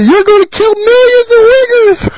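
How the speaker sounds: high, whiny mock voice of a complainer; mocking whine. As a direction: squeal it like a sniveling opponent. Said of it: "then whine like a pussy".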